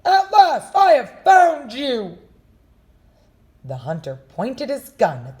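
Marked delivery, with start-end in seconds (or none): 0.1-2.2 high, loud triumphant yell; triumphant shout. Acted. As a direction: shout the catch. Hunter confronts the wolf.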